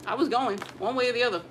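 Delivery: ordinary delivery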